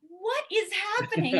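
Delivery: high, drawn-out disbelief; slow, high-pitched retold amazement; astonished. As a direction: act out being floored, on the edge of laughter. Retold astonishment at praise of daughter.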